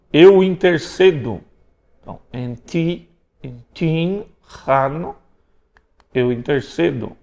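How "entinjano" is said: "Entinjano" said slowly, syllable by syllable; slow pronunciation demo. Shown as a demonstration. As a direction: read "Entinjano" slowly for students. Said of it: "Pronúncia do gama?".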